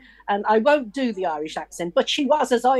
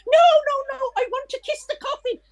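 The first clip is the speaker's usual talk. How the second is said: much higher, frantic cry of no, no, no; imitated cry of protest. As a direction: stop the curtain at all costs. Widow leaping up at curtain.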